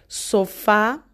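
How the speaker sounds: sofá with a firm final fa; so-FÁ, last syllable strong; stress on FA. Shown as a demonstration. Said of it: "la sílaba más fuerte".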